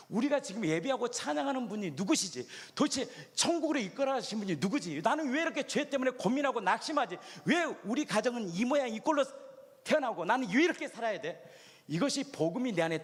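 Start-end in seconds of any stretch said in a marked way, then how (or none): 0.1-11.4 rising, raised pleading questions; impassioned preaching. Acted. Preacher cries out the congregation's despairing questions. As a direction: pour out the questions in anguish.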